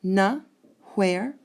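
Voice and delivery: "Na", pause, "where", each a separate beat; teacher counting "Na" and "where" as syllables; syllable-pair scansion. Shown as a demonstration. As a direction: punch out "Na ... where" apart. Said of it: "I've got two syllables there".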